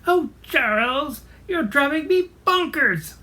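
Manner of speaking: high, exasperated Edith Bunker-like wife; drawn-out, high-pitched scolding squawk; Henrietta Hen. Acted. Hen to her rooster husband, exasperated high Edith Bunker voice. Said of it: "a lot like Edith Bunker".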